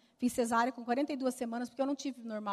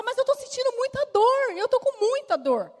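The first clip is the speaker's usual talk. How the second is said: high, strained pleading; pleading in pain; 12 semitones higher, 5 dB louder than usual. Retold or imitated. Patient pleading with the doctor about the pain, high and strained. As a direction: beg them to see the pain. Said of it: "E eu falava".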